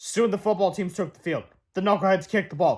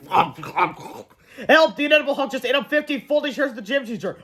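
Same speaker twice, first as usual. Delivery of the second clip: usual speaking voice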